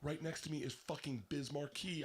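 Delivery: usual speaking voice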